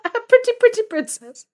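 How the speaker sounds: high, bright-eyed princess, laid on thick; mock princess; pretty princess voice. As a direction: squeal it like a pampered little princess. Playing up princess after friend's joke.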